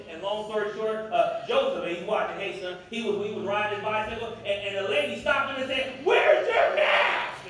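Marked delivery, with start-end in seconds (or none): 6.0-7.4 high, drawn-out full-voiced shout; yelling. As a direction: yell it slowly across at a passer-by. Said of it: "and yelled at him".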